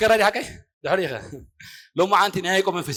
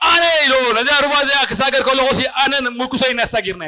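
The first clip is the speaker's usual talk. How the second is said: mildly raised, proclaiming retelling; raised preaching quote; 4 semitones higher, 4 dB louder than usual. Retold or imitated.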